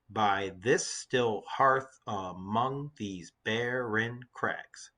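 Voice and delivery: very slow, evenly spaced syllables; deliberate syllable-by-syllable verse reading; counting out syllables. Shown as a demonstration. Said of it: "let's count the syllables in the lines".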